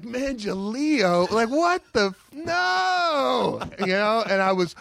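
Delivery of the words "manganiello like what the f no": slow rising question then high cry; mock horrified no; stunned disbelief. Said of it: "I was like".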